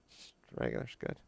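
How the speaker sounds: unvoiced, very quiet mumble; shy kid mumbling to an adult; mumbled kid. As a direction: mumble it barely there like a sulky kid.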